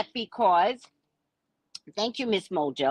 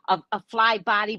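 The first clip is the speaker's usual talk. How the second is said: usual speaking voice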